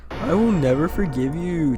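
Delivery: spooky, put-on vengeful voice; lightly eerie, theatrical curse; mock ghostly threat. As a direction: intone it like a vengeful spirit. Voices haunting game threat.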